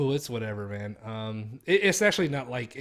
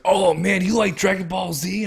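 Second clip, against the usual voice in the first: mock-eager clerk, louder and higher; imitating eager store clerk; 5 semitones higher, 8 dB louder than usual. GameStop clerk greeting a customer in a Dragon Ball shirt, mock-eager and loud. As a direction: play up the pushy GameStop salesman's hook. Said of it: "he was like".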